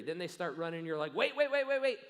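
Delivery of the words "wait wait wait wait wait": high, loud urgent call; calling out wait. Retold or imitated. Parent calling after a running teen, loud and urgent. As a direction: stop the running teen, loudly.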